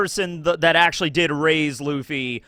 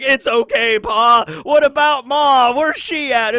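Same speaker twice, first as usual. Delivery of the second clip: loud and high, mock-sweet son; hammed-up Luffy; 8 semitones higher, 7 dB louder than usual. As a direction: play the forgiving kid, overdone. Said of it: "Luffy's like".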